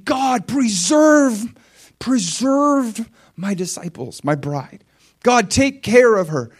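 high, loud, drawn-out plea; crying out in prayer. Acted. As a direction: plead upward to God, stretching the key words. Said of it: "crying out".

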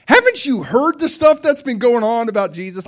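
loud, high, hammed-up disbelief; incredulous. Disciples to disguised Jesus, loudly incredulous. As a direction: exclaim it at someone hopelessly out of the loop.

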